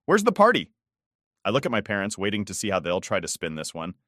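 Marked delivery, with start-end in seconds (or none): 0.0-0.7 put-on high, louder sharp old grandmother; a stern grandma demanding answers.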